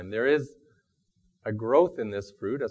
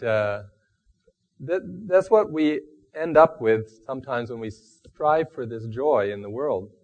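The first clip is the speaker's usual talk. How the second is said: ordinary talk, nothing marked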